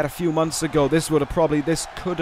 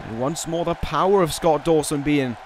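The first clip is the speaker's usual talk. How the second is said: ordinary talk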